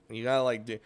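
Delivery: normal talking voice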